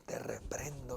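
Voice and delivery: unvoiced, low mock rebuke under the breath; whispered "te reprendo" at the boss. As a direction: hiss a secret rebuke at the boss. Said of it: "jefe dice".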